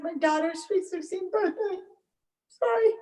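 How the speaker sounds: real tears rising, voice climbing high; choking up. Real, not acted. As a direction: break down crying and apologize. Mother breaks down recounting, apologizes.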